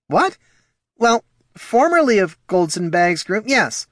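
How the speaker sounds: high, loud flustered gnome. Acted. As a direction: fumble the correction. Poldo caught off guard.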